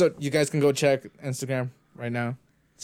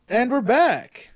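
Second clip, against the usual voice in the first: high, loud, drawn-out show intro; mock announcer shout; announcer-style return; 11 semitones higher, 7 dB louder, much slower than usual. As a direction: belt out a host's welcome-back. Announces return from recording cut.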